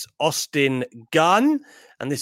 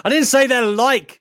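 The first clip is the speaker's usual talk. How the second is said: laughing protest; 8 semitones higher, 7 dB louder than usual. Real, not acted. Exasperated protest at being misquoted.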